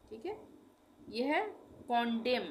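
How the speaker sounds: ordinary talk, nothing marked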